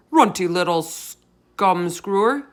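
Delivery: slow, loud giant shout. A narrator's reading. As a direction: spit the insult slowly. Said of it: "he shouted".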